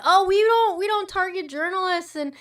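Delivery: loud, high, sarcastic denial; mock the smug official line; sneering imitation of a spokesman. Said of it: "Israel said".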